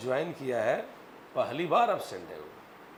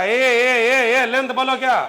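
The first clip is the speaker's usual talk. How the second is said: higher, loud calls to wake the class; calling out; 7 semitones higher, 12 dB louder, slower than usual. Real, not acted. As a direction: call out to get attention. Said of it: "teacher calls the class loudly".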